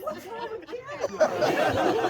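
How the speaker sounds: very high, slow, wounded whine; offended squeal. Retold or imitated. The singer protests the heckler in a high, slow whine. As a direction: stretch it out, high and hurt. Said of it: "And I go".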